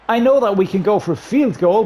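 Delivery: ordinary delivery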